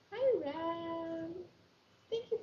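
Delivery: bright, high call-out hello; excited greeting. Real, not acted. Host greeting a viewer joining the livestream, excited. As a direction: greet the newcomer with a lift.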